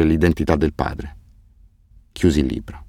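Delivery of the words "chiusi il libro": ordinary delivery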